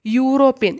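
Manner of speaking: "യൂറോപ്യൻ" starting on a clear "yoo" sound; teacher showing that "യൂറോപ്യൻ" begins with a "y" sound; pronunciation demo. Shown as a demonstration. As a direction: open "യൂറോപ്യൻ" with a firm "y". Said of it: "എങ്ങനെയാണ് pronounce ചെയ്യുന്നത്".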